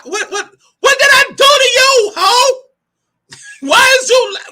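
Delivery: high, loud, laughing mock outrage; exasperated high squeal. To a woman glaring at her, high laughing outrage. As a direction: squeal it like you can't believe she did that. Acted quarrel with catty woman.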